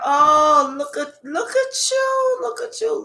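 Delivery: high, teasing spirit voice, well above her own; a sly, sing-song spirit calling them out; Spirit's voice. Acted. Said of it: "Spirit said".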